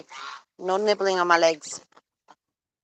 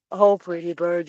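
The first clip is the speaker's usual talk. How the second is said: ordinary talk, nothing marked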